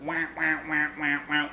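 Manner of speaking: mouthing a wah-wah guitar, lightly voiced; imitating guitar wah. Reviewer showing viewers the Pink Floyd guitar wah, lightly voiced. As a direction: copy the wah pedal sound with your voice. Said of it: "the guitar that kinda makes that same sound".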